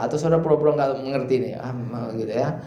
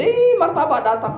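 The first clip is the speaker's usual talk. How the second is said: put-on excited child; 13 semitones higher, 3 dB louder than usual. Children greeting father's snack.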